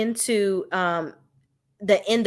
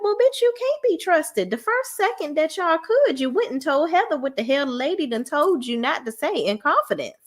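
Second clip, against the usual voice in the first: sassy comeback; 8 semitones higher than usual. Retold or imitated. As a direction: snap back with high mock attitude. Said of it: "I'm like".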